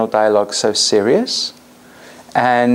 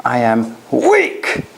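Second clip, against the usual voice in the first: slow, firm and steady; a slow, grounded, strong voice; strong tonality; much slower than usual. Shown as a demonstration. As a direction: say weak words with full conviction. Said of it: "but with a strong tonality".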